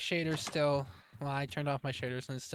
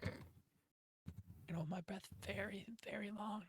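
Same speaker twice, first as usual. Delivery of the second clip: whispering; 4 semitones higher, 12 dB quieter, faster than usual. Pretend. Holding breath underwater, whispering.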